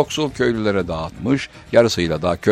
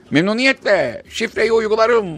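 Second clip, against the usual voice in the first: genie voice; 12 semitones higher, 4 dB louder than usual. Acted.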